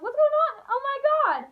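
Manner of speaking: high, loud mock panic; freaking out. Child waking to papa's screaming, freaking out. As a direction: shriek it like a panicked kid. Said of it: "I was freaking out".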